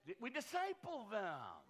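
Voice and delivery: slow, drawn-out Southern preacher lilt; a hint of a southern accent. Real, not acted. Pastor answering the crowd's joke, a hint of Southern accent. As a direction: lean lightly into a Southern twang. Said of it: "Did you say that with a southern accent?".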